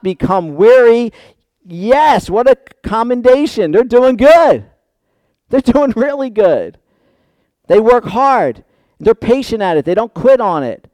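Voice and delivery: loud, high-pitched exclaiming from the pulpit; impassioned preaching. Real, not acted. Preacher celebrating the church's commendation, impassioned preaching. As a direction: proclaim the praise with force.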